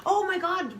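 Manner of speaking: high mock squeal of delight; gushing squeal. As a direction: squeal it like a starstruck fan. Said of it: "be like".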